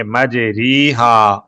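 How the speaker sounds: "مجری ہا" as maj-REE-haa; long ee after the r in "مجری ہا"; written "ri" reading. Shown as a demonstration. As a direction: read "مجری ہا" as spelt, with ee. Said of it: "لفظ لکھنے میں".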